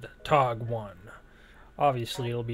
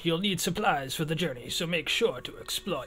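higher, theatrical briefing voice; lilting, raised-pitch game narrator; put-on reading voice; 4 semitones higher than usual. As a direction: read it out like a hammy mission briefing. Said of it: "I don't know why I'm talking like this".

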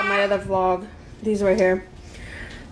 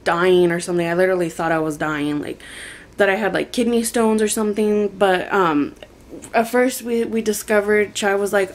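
ordinary talk, nothing marked

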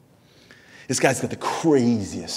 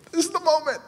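mock dramatic.

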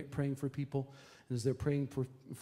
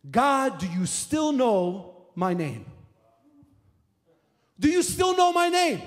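loud, high, pleading cry to God; desperate cry to God; 10 semitones higher, 9 dB louder, slower than usual. Retold or imitated. Woman at the altar pleading with God, loud desperate cry. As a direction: cry out, begging to be known.

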